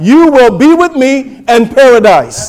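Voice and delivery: high, loud preaching of a promise; emphatic proclamation. Retold or imitated. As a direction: declare it triumphantly to the congregation. Preacher voices Jesus' promise.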